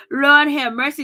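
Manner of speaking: a loud, exasperated Lord have mercy; loud exclamation. Real, not acted. Recapping the show, she cries out at Giselle, loud. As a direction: throw it out loud in disbelief.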